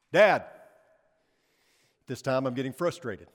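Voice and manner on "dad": loud retold child's shout for Dad; calling out. Son calls dad once more at bedtime, calling out. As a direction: holler for Dad across the house. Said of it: "I hear it again".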